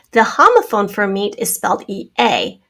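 ordinary talk

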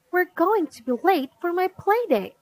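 put-on high, quick childish fretting; urgent complaint. A narrator's reading. Girl late for play date, urgent complaint. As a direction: hurry mom along in a fussy girl's voice.

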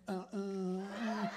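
soft whispered grunts, slow; breathy make-out noises. Pretend. Comic acting out a make-out session, panting softly. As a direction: pant softly like kissing in the car. Mimics making out.

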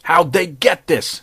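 slow, high, loud panicked scream. Acted. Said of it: "screamed Greasy".

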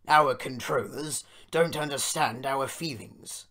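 loud, put-on commiserating voice; sympathising engine. A narrator's reading. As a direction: commiserate like a big, self-important engine. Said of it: "sympathised Gordon".

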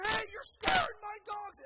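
loud, high angry shout; imitated screaming dog owner; screaming neighbor. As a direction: yell it across the yard in outrage. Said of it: "starts screaming at my wife".

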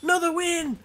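high, loud whoa of surprise; delighted whoa. Real, not acted. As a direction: squeal at another win. Another victory.

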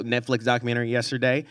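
ordinary delivery

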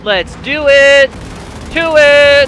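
slow, loud, high rallying shout; loud drawn-out gamer cheer; hyped battle cry. Real, not acted. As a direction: fire yourself up to charge.

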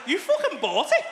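loud, high laughing protest. Retold or imitated. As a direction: shout it back laughing at the wife's hypocrisy. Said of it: "I was like".

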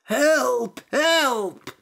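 high, loud storybook cry for help; shouted plea. A narrator's reading. Priest shut inside a basket shouts for help. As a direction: call out for rescue, pitched high. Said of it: "shouted the priest".

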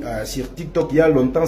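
ordinary talk

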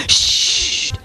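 long breathy "sst"; urgent shush. A narrator's reading. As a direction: cut the neighbour off with a shush. Neighbour hushes the other. (waarschuwend sissen)

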